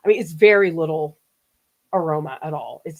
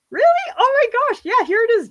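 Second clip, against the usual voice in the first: surprised; 6 dB louder than usual. Real, not acted. Reacting to viewer's surprising comment.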